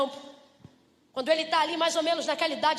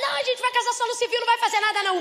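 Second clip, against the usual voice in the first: high, fast, louder dismissive bride; mock modest bride; 8 semitones higher, 4 dB louder, faster than usual. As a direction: brush it off like a coy bride. Noiva fala do casamento simples. (noiva fingindo modéstia, aguda e rápida)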